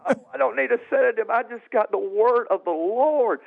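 high, insistent elation; excited retelling. Retold or imitated. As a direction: wave off the offer, bursting with good news. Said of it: "You're so excited".

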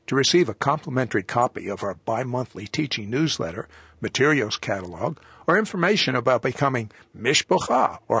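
brisk closing read of web and phone details; announcer read. Acted. Host reading show contact details to viewers, announcer style. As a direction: read the contact info like a broadcast tag.

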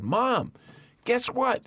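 put-on high, eager child calling his mom; excited little boy. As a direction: burst in with big news like a small kid. Child runs to mother excited.

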